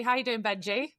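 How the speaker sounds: normal talking voice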